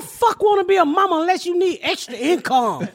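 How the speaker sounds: mock rant. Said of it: "I was like".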